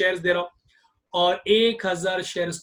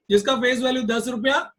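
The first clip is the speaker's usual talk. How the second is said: ordinary talk, nothing marked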